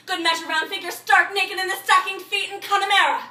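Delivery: loud, high, building declamation; frantic build to a near shout; mounting rant. Acted. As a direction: climb steadily to a ringing peak. Acted rant builds to a peak.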